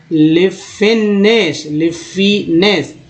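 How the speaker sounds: लिफिनेस said slowly, syllable by syllable, lif-i-ness; slow spelled-out word. Shown as a demonstration. Teacher showing students how to read the word, drawn out slowly. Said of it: "इन भी पढ़ना और ... नेस भी पढ़ना".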